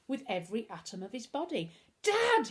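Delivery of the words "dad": high, loud call for Dad; urgent high-pitched shout. A narrator's reading. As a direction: cry out to your father at full voice. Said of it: "he shouted".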